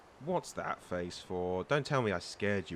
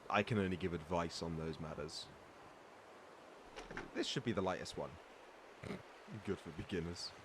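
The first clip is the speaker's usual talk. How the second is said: normal talking voice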